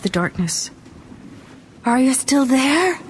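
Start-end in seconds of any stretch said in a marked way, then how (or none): 1.9-3.0 high, rising urgent question; César's anxious check. Acted. César checks on Angelique in hiding, anxious. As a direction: call down to see she is there.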